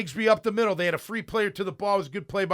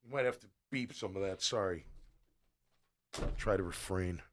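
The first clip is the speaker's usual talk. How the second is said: very low, hushed apologetic aside; quiet, low-voiced sorry after an outburst; subdued apology; 12 semitones lower, 13 dB quieter than usual. Real, not acted. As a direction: drop way down in pitch and volume, sheepishly. Sheepish apology after getting carried away.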